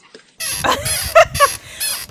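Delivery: high embarrassed laugh. Real, not acted.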